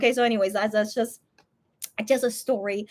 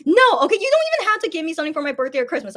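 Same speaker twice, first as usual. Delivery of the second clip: exasperated protest; 5 semitones higher, 5 dB louder, faster than usual. Retold or imitated. Arguing with brother over gift.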